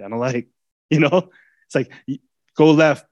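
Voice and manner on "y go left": louder, firm command; commanding driver. Retold or imitated. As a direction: bark it at the beast. Master steering stubborn beast.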